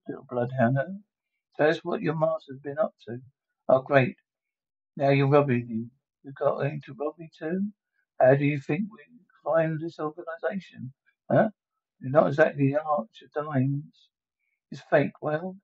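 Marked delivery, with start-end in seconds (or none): none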